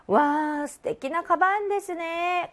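high, drawn-out wow of admiration; acted high-pitched praise; admiring compliment. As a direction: gush over something stylish. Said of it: "뭘 칭찬했을까요?".

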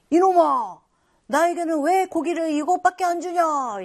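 a nobleman yelling at the butcher over the smaller cut; angry nobleman. Retold or imitated. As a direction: shout in outrage at the butcher. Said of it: "A 양반이 화가 났죠".

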